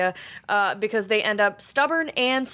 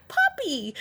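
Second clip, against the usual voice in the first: excited squeal; 12 semitones higher than usual. Retold or imitated. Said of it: "I would turn around like".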